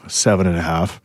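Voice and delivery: talking while chewing gum; words round a wad of gum. Real, not acted. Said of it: "As he says that with, like, liquid in his mouth".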